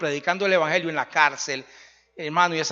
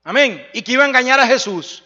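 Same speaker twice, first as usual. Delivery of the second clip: loud, rising preacher's climax; jailer's conversion preached loudly to the congregation; fiery preaching; 5 semitones higher, 8 dB louder than usual. Acted. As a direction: preach it out at full force, building. Preaching climax at full force.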